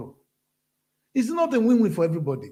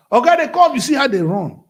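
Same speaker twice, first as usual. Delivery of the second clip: a loud, higher hint of an eager policeman; mock police orderly; 7 semitones higher, 10 dB louder than usual. Imitating police rushing for their boss, loud mock call. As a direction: call out like a servile guard.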